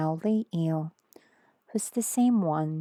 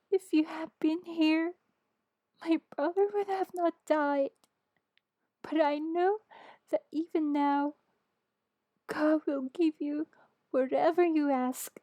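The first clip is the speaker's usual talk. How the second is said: much higher, lighter voice for Martha; Martha voiced high; 10 semitones higher than usual. A narrator's reading. Martha tells Jesus her brother would not have died, high voice.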